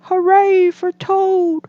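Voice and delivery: high, loud cheer from a small frog; shout. A narrator's reading. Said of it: "as he shouted".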